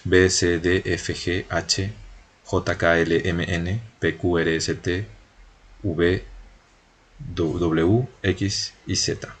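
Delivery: B to Z read off by their Spanish names; Spanish letter names. Shown as a demonstration. Teacher lists French alphabet consonants to learners, Spanish letter names. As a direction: recite B, C, D through Z as Spanish letters, not French. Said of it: "Lo pronuncié en español".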